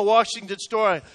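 ordinary talk

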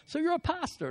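high-pitched imitated British question; British accent. British caller questioning the pastor, imitated British accent. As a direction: ask it in a put-on British accent. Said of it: "I loved his accent".

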